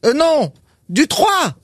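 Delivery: escalating call. Retold or imitated. Pharmacist's escalating calls.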